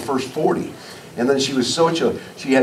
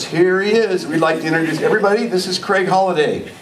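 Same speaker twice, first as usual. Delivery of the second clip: teacher announcing; 5 semitones higher than usual. Retold or imitated. Teacher introducing new student to class.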